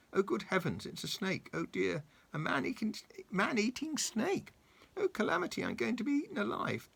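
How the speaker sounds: high, fussy fearful wailing; hammed-up panic. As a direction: play the timid gentleman losing his nerve. Mister Jelly panics at worm.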